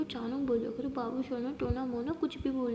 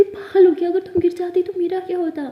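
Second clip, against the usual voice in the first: loud, high angry outburst; frightened scolding; 6 semitones higher, 12 dB louder than usual. Acted. Aliya scolds Maya after saving her, shouting in fright.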